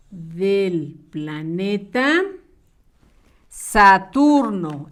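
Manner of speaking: very slow, word by word, each syllable drawn out; slow dictation. Shown as a demonstration. Teacher dictating the answer to young pupils, slow dictation. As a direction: dictate it slowly so children can write. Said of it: "va con mayúscula".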